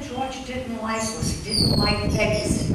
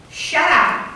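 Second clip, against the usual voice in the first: loud, higher hush from the crowd; mock crowd snapping; 6 semitones higher, 5 dB louder than usual. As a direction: silence the beggar sharply. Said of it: "And the people said".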